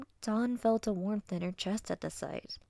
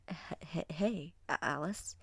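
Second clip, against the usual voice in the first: put-on halting, timid boy; nervous stammer; 5 dB quieter than usual. Wolf boy greeting the girl he likes, nervous stammer. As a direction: stumble shyly over each word. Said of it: "the semi-nervous wolf boy".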